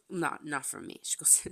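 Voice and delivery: ordinary delivery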